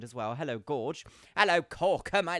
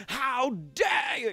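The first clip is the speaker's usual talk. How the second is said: hammed-up "how dare you", louder and higher; mock outraged shout; 9 semitones higher, 7 dB louder, slower than usual. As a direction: play-act a livid diva yelling. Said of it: "screaming livid at someone".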